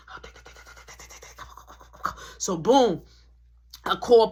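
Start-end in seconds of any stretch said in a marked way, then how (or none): 0.1-2.2 frantic whispered self-talk; panicked whisper. Retold or imitated. Panicking sibling urging themself on, frantic whisper.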